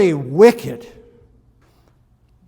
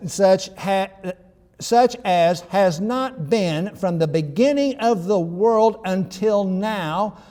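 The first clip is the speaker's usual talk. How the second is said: usual speaking voice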